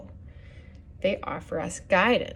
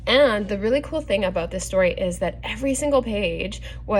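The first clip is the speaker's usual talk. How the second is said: usual speaking voice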